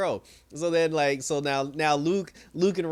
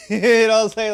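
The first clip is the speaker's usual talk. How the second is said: breaking into real laughter, higher and louder; laughing; 7 semitones higher, 12 dB louder than usual. Real, not acted. Cellmates' reaction to the random dude, retold laughing. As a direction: crack up mid-phrase and keep talking.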